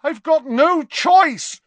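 high, strained and slow, near breaking; a cornered boy forcing the words out; desperate. Acted. Malfoy to Dumbledore, cornered and desperate.

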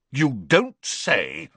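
slow, biting sarcasm; furious sarcasm. Acted. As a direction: drag it out bitterly. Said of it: "said the Prime Minister furiously".

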